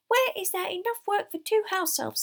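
high, loud squeaky elf; piercing little elf voice; shrill shouting. A narrator's reading. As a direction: keep shouting the story shrilly. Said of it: "shouting shrilly over Winky's screeches".

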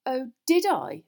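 high, loud, affronted challenge; bristling retort; dangerous indignation. A narrator's reading. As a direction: throw it back indignantly. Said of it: "her eyes flashed dangerously".

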